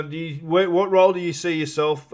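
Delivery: ordinary delivery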